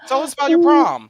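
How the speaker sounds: high-pitched excited question; eager, high and loud. Pretend. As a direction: ask it loudly, bursting with interest. Eager hosts asking about prom.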